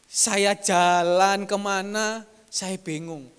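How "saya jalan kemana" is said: drawn-out, lost and dazed; slow dazed. Acted. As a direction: stretch it out like someone lost. Preacher dramatizes confusion. (pelan, bingung)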